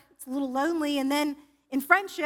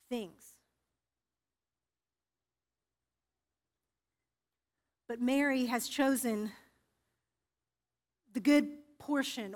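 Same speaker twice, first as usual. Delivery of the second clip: Jesus' gentle rebuke; 3 semitones lower, 5 dB quieter than usual. Acted. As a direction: speak as Jesus, calm and loving. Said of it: "Jesus turns and he says".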